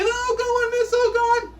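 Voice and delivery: very high pleading whine; whiny kid pleading. Retold or imitated. Whiny pupil pleads with miss, very high whine. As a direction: beg like a little kid. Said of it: "The whiny ones".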